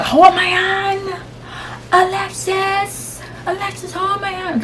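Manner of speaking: high, drawn-out plea for help; calling out. Retold or imitated. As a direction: re-enact calling for a hand, slow and high. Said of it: "I just calling out".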